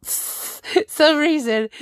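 slow, giggling repeat of the words; can't get the phrase out for laughing; laughing through it. Real, not acted. Streamer cracking up retelling the Cesaro tweet, slow giggling.

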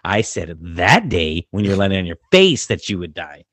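emphatic, exasperated retelling; loud mock correction. Retold loud correction of athlete.

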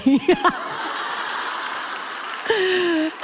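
high, helpless burst of laughter. Real, not acted. Laughing hard while telling friends the camo story. Nuns mistake camo for military.

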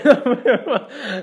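bright burst of laughter. Real, not acted. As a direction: laugh out loud. Laughing at own joke.

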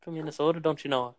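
mock Minnesotan with long O's; Minnesota accent. Echoing the friend's joke, mock Minnesota accent. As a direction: do a folksy Minnesota "don't you know". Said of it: "you get to Minnesota".

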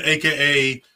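ordinary talk

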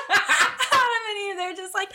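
real laughter through the words, pitched high; high, giggling delivery; laughing. Real, not acted. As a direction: try to talk while cracking up. Cracking up while telling the story.

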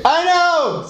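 very high, shrill-sounding yelp "I know"; Monica shrieking. Retold or imitated. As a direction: squeal it out like Monica losing it. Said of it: "siempre gritaba Mónica".

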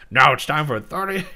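exaggerated announcing in a put-on voice; Strong Bad. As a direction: belt it out as a silly character impression. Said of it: "now I'm just doing strong bad".

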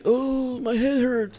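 put-on whiny hurt kid. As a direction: whine it like a bratty hurt boy. Voicing crying bully.

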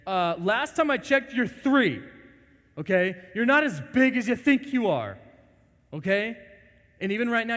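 loud, high exasperated scold; exasperated parent telling off a child; stern parent scolding. Retold or imitated. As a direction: scold the three-year-old, loud and high.

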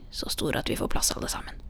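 hushed, quick, whispery and sly. A narrator's reading. Grandmother adds that the hole fits all of them, slyly hushed. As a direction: slip it in slyly under the breath. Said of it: "og tilføyde lumsk".

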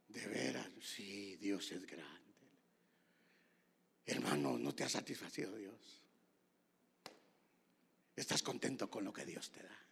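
low, soft, reverent preacher's voice; hushed sermon aside to the congregation; hushed preaching. Real, not acted. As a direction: preach it softly and intimately, sinking low.

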